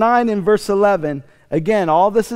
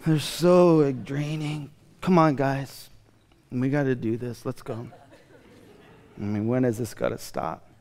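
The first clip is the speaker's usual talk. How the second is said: weary Jesus; 6 semitones lower, 6 dB quieter than usual. Retold or imitated. Jesus urging disciples on, quietly weary. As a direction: drag through it, worn out. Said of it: "he's so exhausted".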